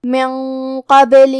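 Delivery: slow chant with heavy nasal; tajweed recitation. Shown as a demonstration. Teacher demonstrating heavy ghunna, slow recitation. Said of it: "غنہ کو بھی بھاری آواز میں ادا کریں گے".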